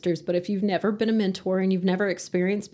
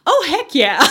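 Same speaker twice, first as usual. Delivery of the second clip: loud, higher whoop of approval; delighted cheer; 4 semitones higher, 9 dB louder, slower than usual. Retold or imitated. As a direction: burst out with it, laughing after. Acting out delight at the Big Texan.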